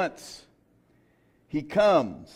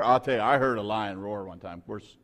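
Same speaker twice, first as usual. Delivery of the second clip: ordinary talk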